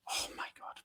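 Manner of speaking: a barely audible, disbelieving whisper; exasperated whisper. Real, not acted. Vlogger giving up on the booking, exasperated whisper. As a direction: breathe it out under your breath, unvoiced.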